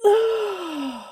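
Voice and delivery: sudden sharp sneeze; loud sneeze. Real, not acted. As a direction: let a big sneeze burst out. The sneeze finally comes.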